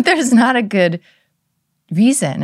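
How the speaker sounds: hammed-up indignant child; exaggerated daughter insisting; imitating daughter. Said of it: "She doesn't talk like that at all".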